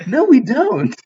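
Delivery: high, loud mock-English protest; bad English accent. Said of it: "That was a really bad accent".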